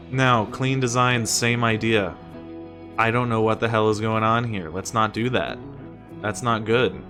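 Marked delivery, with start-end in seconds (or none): none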